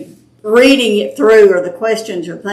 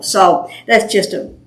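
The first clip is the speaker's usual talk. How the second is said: ordinary talk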